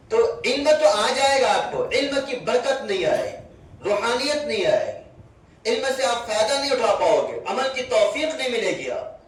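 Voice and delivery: put-on earnest sermon to young students; preaching to children. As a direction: drive the point home to the kids.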